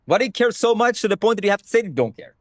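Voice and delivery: ordinary talk, nothing marked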